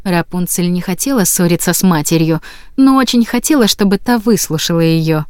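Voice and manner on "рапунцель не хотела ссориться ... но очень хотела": plain reading voice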